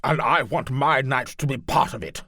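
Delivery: high, loud, eager noble; eager knight lord. Acted. Landenberg demanding.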